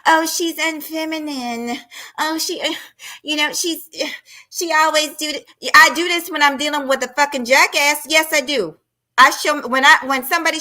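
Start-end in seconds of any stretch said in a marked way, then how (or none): 0.0-5.5 mocking chat voice. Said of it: "I saw people in the chat".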